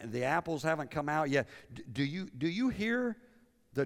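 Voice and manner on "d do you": ordinary delivery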